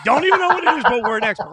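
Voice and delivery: high, loud comic exclamation; loud, jumped-up mock outrage; mock-indignant outburst. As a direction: burst out high and loud, hamming up the absurdity. Mock outburst of instant expertise.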